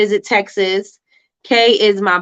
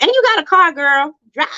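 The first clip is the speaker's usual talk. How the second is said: high, loud excited push; excited urging; 8 semitones higher, 4 dB louder than usual. Real, not acted. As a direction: hype her up to go. Urging viewer to travel.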